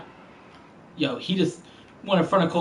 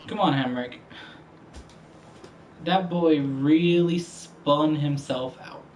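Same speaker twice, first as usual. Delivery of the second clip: ordinary talk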